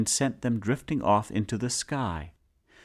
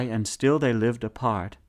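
plain reading voice

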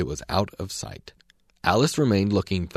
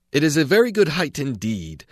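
higher, louder indignant retort; angry caterpillar; 7 semitones higher, 4 dB louder than usual. A narrator's reading. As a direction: bristle at the insult and snap it back. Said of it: "said the caterpillar angrily".

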